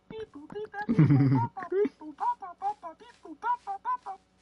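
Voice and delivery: high, loud singsong beeps; phone dial beeps. Retold or imitated. Imitating Frank dialing his office phone, high beeps. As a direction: chirp the keypad tones up high. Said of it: "he goes".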